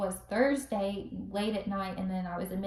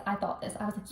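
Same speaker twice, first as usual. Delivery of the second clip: ordinary talk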